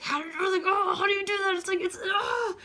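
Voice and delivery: high, loud re-enacted outrage; exasperated outburst. Reacting to some stranger burning her with a cigarette, high exasperated yelp. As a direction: act out an indignant cry that climbs into a yell.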